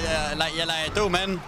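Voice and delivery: dumb guy act. Acted.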